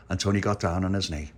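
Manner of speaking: plain reading voice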